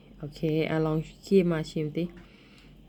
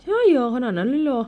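surprised; 6 semitones higher, 4 dB louder than usual. Real, not acted. Mother doubts the child's rating.

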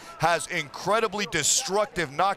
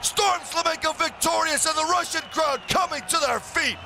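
excited play-by-play; 7 semitones higher than usual. Real, not acted. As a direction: call the knockout at full hype. Commentator calls the knockout.

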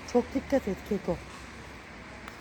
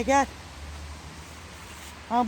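normal talking voice

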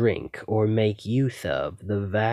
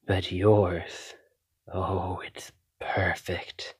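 hungry whisper; slower than usual. Acted. Vampire savoring her captive's blood, slow whisper. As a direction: breathe it out as if tasting it.

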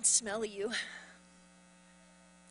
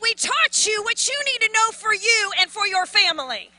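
loud, high preaching at full force; impassioned preaching; 8 semitones higher, 8 dB louder than usual. Real, not acted. Pastor urging congregation to climb out themselves, impassioned and loud. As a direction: raise the voice and drive it home.

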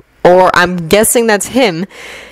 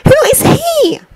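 put-on high, cross schoolgirl whispering at the boys; a tired, annoyed girl woken at night, pitched up high; Hermione's voice.

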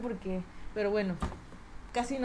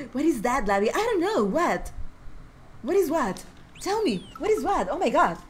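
mock excited English; 4 dB louder than usual. Mock English banter, laughing.

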